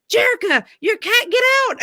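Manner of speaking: high, loud call across the yard. Retold or imitated. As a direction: shout to warn her the cat's out. Neighbor calling across the yard.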